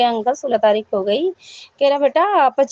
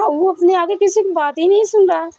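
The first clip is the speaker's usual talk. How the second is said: exasperated, nearly shouting the complaint; exasperated outburst; 7 semitones higher than usual. Real, not acted. Venting to the congregation about the uncle's son, exasperated raised voice.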